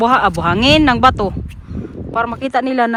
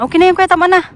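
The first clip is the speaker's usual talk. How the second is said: loud call to stop; 7 semitones higher, 9 dB louder, faster than usual. Real, not acted. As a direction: call out across the ice. Calling out to son.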